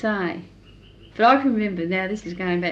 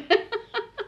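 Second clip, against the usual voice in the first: high, helpless laugh; burst of laughter; 14 semitones higher than usual. Real, not acted. Daughter after the unkilled chook punchline, bursting into laughter. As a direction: crack up at your own punchline.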